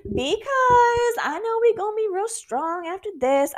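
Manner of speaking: fake-grateful prayer; sarcastic thanks to God after a fight with husband; mock gushing gratitude. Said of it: "It's not an instant reaction for me to be like".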